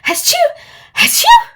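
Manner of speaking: high, loud playful fake sneezes; mock sneezing. Dizzy asks the pig a riddle, with mock sneezes. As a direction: sneeze it out big and silly. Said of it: "what's fat and pink and goes".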